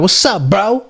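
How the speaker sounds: high, bright mock greeting to a buddy; friendly greeting. Greeting a guy he wants to befriend, high and friendly.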